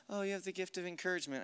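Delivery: hushed, underpowered imitation; soft encouragement. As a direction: say it small and soft, as if it were feeble. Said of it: "It sounds so soft".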